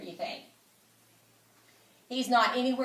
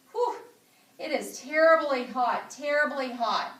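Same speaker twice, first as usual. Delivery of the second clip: overheated exclamation; 5 semitones higher, 5 dB louder than usual. Acted. Child complaining about heat.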